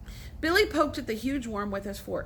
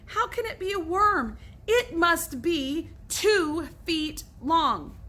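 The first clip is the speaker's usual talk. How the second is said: indignant yell; 6 semitones higher, slower than usual. A narrator's reading. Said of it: "yelled Billy".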